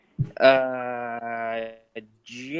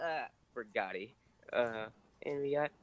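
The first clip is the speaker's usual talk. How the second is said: ordinary talk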